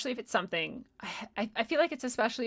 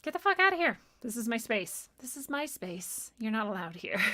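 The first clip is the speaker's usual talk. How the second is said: commanding banishment; 3 semitones higher than usual. Retold or imitated. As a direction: throw the entity out of your space. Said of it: "all it takes is you being like".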